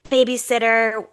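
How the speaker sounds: ordinary delivery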